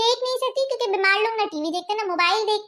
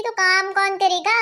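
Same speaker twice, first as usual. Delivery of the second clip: ordinary talk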